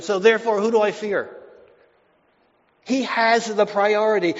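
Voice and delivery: loud, driving pulpit preaching; full-force preaching. Acted. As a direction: preach it with a ringing, confident voice. Preaching David's psalm confidence.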